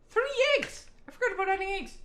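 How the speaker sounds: high-pitched squeal of dismay; alarmed realisation. Real, not acted. Streamer realises he forgot the eggs, alarmed squeal. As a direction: shoot up high in sudden alarm.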